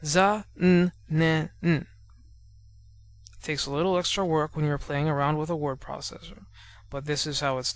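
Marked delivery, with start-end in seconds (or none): 0.0-1.5 za-n-ne-n said syllable by syllable, each one separated and louder; teacher sounding out za-n-ne-n; spelled out syllables. Shown as a demonstration. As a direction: break za-n-ne-n into its four beats. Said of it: "And we write it like this".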